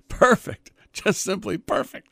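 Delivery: high, loud burst of delight. Real, not acted. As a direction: gush over it, thrilled. Delighted by the parody image.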